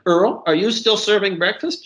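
high-pitched call across the room; mild shout. A narrator's reading. Waitress to cook back at the stove, mildly shouted question. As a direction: raise it high to reach the cook. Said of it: "she shouted to Earl".

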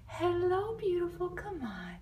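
high, sing-song coo to a shy cat; gentle high pet voice. Real, not acted. Coaxing the cat out.

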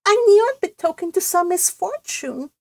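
high-pitched, sniffy character voice; sniffy cousin. A narrator's reading. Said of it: "sniffed Cousin Georgiana".

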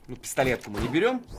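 ordinary talk

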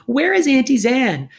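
high, loud, slow wail. A narrator's reading. Firion missing Auntie Zan, high loud slow wail. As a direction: wail it out like a lost child. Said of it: "Firion wailed".

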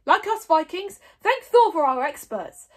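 loud, high and emphatic; rallying declaration. A narrator's reading. Stoic praising Viking experts, rallying. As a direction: declare it proudly to the crowd.

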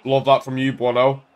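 ordinary talk, nothing marked

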